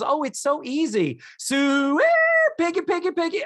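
high, rising sooey piggy call; hog call. Retold or imitated. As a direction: call the pigs home in a high sing-song. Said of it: "the Craig goes".

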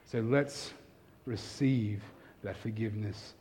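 quiet, slowing call to receive forgiveness; hushed invitation. Real, not acted. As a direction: draw them gently into prayer. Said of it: "Let's pray".

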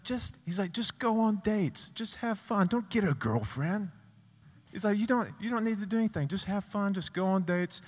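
impression of coach. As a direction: do the coach's breezy high voice.

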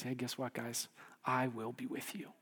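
low, very soft comfort; gentle reassurance. Acted. Preacher as Jesus comforting troubled disciples, low and hushed. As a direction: quietly settle troubled hearts.

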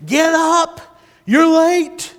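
high, loud mock yell; yelling wake-up call. Parent yelling a sleeper out of bed, loud mock yell. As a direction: bark them awake. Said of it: "somebody yelling".

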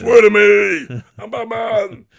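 full-blast raspy growled shout; over-the-top superhero bellow; Batman. Acted. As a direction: roar it high and drawn out, throat-shredding.

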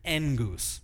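"Engus" with no D sound; Greek engus, no D. Shown as a demonstration. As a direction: blend and-goose, drop the D in "Engus". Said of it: "Drop out the D".

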